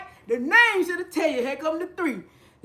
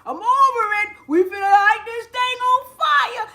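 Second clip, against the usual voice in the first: imitating Ovi; 10 semitones higher than usual. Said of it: "Mister Ovi say".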